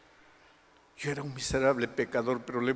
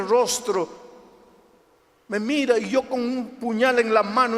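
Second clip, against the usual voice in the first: high, loud, anguished retelling; loud, high-pitched pulpit fervor; impassioned testimony preaching; 8 semitones higher, 8 dB louder than usual. Retold or imitated. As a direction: preach the nightmare at full voice. Preaches a testimony at full force.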